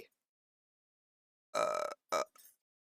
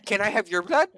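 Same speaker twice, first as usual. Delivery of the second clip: high, loud, over-keen question; eager request; 10 semitones higher, 8 dB louder than usual. Acted. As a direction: pounce on it in a high, excited voice. Scientist eagerly asks the next visitor.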